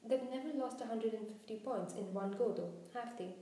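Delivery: ordinary delivery, nothing marked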